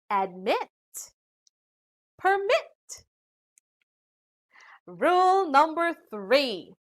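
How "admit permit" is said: "Admit, permit" with the weight on MIT; second-syllable stress. Shown as a demonstration. Teacher showing two-syllable verbs, "Admit, permit" stressed on the second syllable. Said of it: "the stress is on the second syllable".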